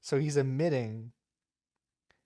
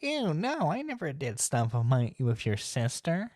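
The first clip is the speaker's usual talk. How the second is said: mocking flamboyant man, pitched way up on "Ew, no"; 3 semitones higher than usual. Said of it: "this very flamboyant man".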